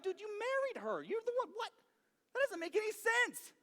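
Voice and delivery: high-pitched incredulous protest; squeaky, baffled objection, played up; mock disbelief. Preacher questioning Saul about his wife, high incredulity.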